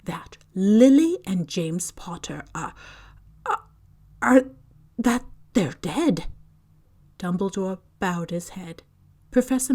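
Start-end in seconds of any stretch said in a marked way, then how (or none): 0.1-6.3 faltering. A narrator's reading. Cannot bring herself to say it.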